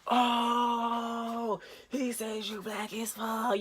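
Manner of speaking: high, drawn-out mock "ohh"; hushed high crowd-going-wild imitation; crowd jeering reaction. Imitates classmates reacting to the insult.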